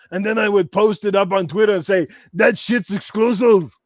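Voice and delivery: full podcast rant in a held Arnold voice; Austrian-accented action-star voice, kept up line after line; Arnold Schwarzenegger impression. Podcast host answering viewers in character as Arnold, Austrian action-star voice. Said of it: "enough Arnold questions".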